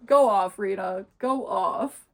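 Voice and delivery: loud, emphatic hype; cheering on. Retold or imitated. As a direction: egg her on with a punched-out cheer. Cheering on the character's comeback.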